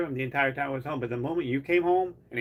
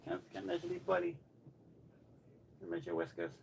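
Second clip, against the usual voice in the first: softer, higher coaxing voice; soft pet talk; 4 semitones higher, 11 dB quieter, much faster than usual. Real, not acted. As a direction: ask the cat gently. Talking softly to cat.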